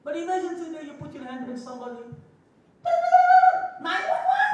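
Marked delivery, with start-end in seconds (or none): 2.8-3.8 shrill mock laugh, much higher and louder; a squealing laugh mocking a wife calling for help. Mocking a modern wife's reaction. 3.8-4.4 squeaky, much higher, louder nine one one; a wife mockingly shrieking for the police; mock squeal.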